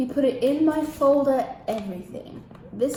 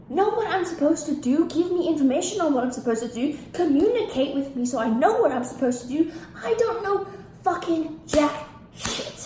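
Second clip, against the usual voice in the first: higher-pitched, indignant venting; exasperated rant; 5 semitones higher, 3 dB louder than usual. Real, not acted. Demanding information on what to do, exasperated. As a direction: hammer out each demand in exasperation. Continues angry retort.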